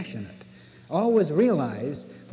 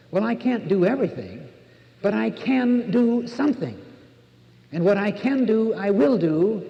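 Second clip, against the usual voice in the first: higher, louder, rising resolve; determined self-talk; 5 semitones higher, 3 dB louder than usual. Retold or imitated. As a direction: say it like a vow to yourself. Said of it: "how you evaluate yourself".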